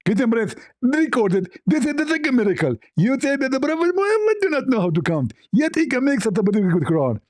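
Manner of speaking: high-pitched caricature voice, rising as he gloats; mock a triumphant debater in a squeaky voice; hammed-up gotcha in a put-on high voice; Zakir Naik. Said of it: "Like, Zakir, hold on".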